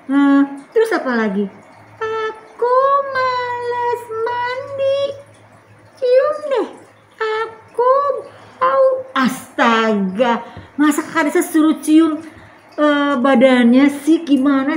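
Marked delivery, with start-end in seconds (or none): 2.0-8.9 put-on high, slow child voice; little Tiur. Tiur telling her sister she skips baths, high and slow. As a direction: whine it like a lazy little kid.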